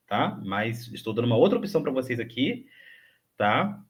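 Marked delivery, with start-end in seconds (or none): none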